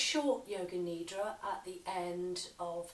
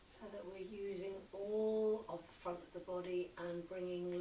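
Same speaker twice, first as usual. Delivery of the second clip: slow yoga nidra guidance with long pauses; unhurried meditation voice, letting phrase endings sink; guided meditation. Acted. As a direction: lead them gently into relaxation, phrase by phrase. Leads a relaxation practice.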